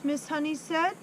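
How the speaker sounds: usual speaking voice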